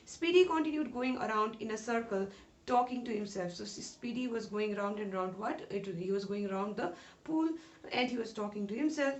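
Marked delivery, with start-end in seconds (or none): none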